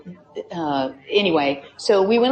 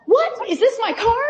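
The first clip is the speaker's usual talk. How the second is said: put-on high, delighted squeal of a teen girl; excited teen surprise; thrilled daughter; 4 dB louder than usual. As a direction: gasp with joy at a new car. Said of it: "the daughter comes out".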